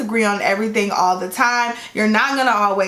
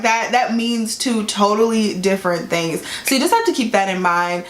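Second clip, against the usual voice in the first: usual speaking voice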